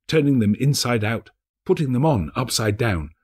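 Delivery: ordinary delivery, nothing marked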